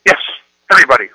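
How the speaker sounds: loud, flat insistence on everybody; a teen digging in her heels; insistent daughter. Retold or imitated. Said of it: "my daughter says".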